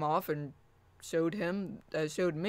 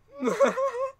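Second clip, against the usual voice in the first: high squealing laugh; 8 dB louder than usual. Real, not acted. Laughing at robot voice.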